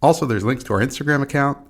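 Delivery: upbeat sponsor ad voice; ad read. Acted. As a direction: sell the app like a radio spot. Reads a sponsor ad.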